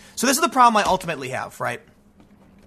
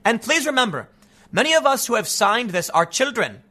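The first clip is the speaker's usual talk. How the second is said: raised-pitch activist impersonation; put-on higher voice; mock Greta Thunberg; 3 semitones higher than usual. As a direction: read it as a mocking imitation of a young activist. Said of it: "She said".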